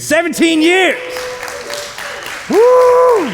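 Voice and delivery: high, loud whoop of joy; excited cheer. Real, not acted. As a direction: shout it out like a real celebration. Celebrating seventeen years of marriage.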